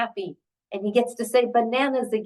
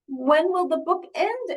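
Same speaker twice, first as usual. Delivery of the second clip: higher-pitched worried question; distressed Elephant; 6 semitones higher than usual. Acted. Elephant asks Piggy about the book's end, distressed. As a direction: ask in mock distress, pitched up. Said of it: "He seems quite distressed".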